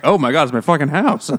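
mock outrage. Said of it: "the master's gonna be like".

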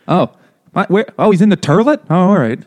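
put-on high, loud old Italian grandma. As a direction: squawk it like a nonna calling. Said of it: "how my Italian grandma and great grandmother said it".

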